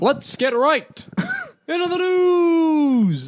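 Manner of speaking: Keemstar impression. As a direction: stretch it out like a hyped YouTube news host. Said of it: "That was a Keemstar joke".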